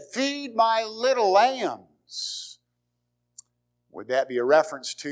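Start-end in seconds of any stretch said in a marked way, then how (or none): 0.0-2.6 1 tender plea. Acted. Jesus tenderly repeats charge.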